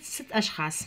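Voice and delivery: normal talking voice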